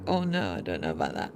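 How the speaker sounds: half to herself, unsure; a hushed, doubtful muttered quote; doubtful aside. Retold or imitated. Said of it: "I was like".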